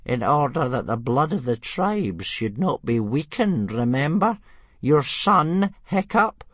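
put-on Old Wrinkly sternly reminding the chief; stern old man. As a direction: scold the chief like an elder. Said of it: "Old Wrinkly looked stern".

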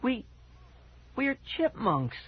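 high, slow squeaky critter voice; a stunned little chipmunk squeak; tiny chipmunk voice. A narrator's reading. Girl turned into chipmunk.

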